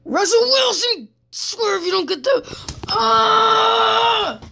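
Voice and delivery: high strained yell breaking into a scream; frustrated outburst. Real, not acted. Gamer to his quarterback as the play fails, frustrated screaming. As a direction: rage at the player, high-pitched.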